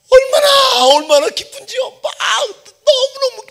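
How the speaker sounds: high loud cry of joy; overjoyed. Acted. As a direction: burst out with joy. Overjoyed retelling, crying out. (감격에 겨워 높게 외치며)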